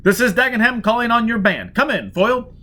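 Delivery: a rival calling on your band; Dagenham hailing. Acted.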